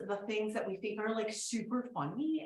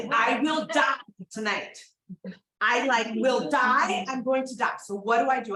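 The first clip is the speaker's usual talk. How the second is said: louder, panicked cry of I'm going to die; panicked self quote; 10 dB louder than usual. Retold or imitated. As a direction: shout the panic like doom is certain. Retelling own panic, shouting.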